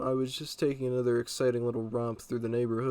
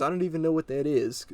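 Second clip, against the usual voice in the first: higher-pitched puzzled protest; confused mom; 4 semitones higher than usual. Retold or imitated. Mom answering cop about lean, confused. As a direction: imitate a baffled mother, pitched up a little. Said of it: "really confused".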